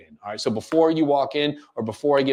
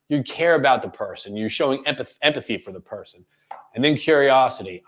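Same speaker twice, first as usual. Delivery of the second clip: ordinary talk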